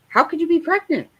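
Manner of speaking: high, fast shocked disbelief; incredulous. Retold or imitated. To a pregnant schoolmate, shocked disbelief. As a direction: act out a stunned what.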